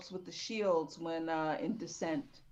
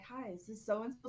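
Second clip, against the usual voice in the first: sexy voice; 4 semitones higher, 7 dB quieter than usual. Retold or imitated. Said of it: "Sexy voice and all".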